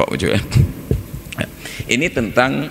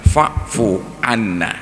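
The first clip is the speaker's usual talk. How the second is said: slow careful recitation; much slower than usual. Shown as a demonstration. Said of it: "mutaaddi dengan lam, dengan an".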